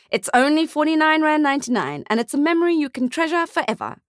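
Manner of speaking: high, oily salesman voice; smarmy sales pitch. Acted. Photographer to the father, high and smarmy. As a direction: sell it like an advert.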